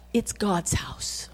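hushed and slow, reverent whisper. Acted. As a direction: breathe it like a secret, slowly. Reverent aside to workers.

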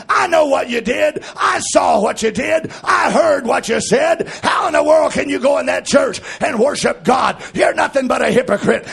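loud, high shouted preaching; devil's accusations hurled at full shout; full-force preaching. Acted. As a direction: preach it hard to the congregation.

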